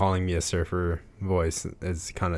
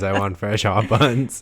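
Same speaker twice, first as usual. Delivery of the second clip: louder, teasing hot buns; playful; 3 semitones higher, 8 dB louder than usual. Pretend. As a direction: ham it up like a craving. Joking demand for buns.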